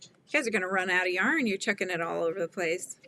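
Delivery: ordinary talk, nothing marked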